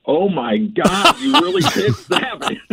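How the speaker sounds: drawn-out mock amazement rising very high; imitating stunned disbelief. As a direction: play the shocked onlooker, stretched out then high-pitched. Hunters react to bloody scene.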